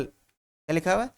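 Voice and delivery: normal talking voice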